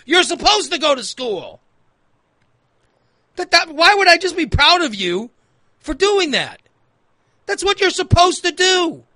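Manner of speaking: high-pitched, incredulous scolding; acted exasperation. Acted scolding of boastful kids.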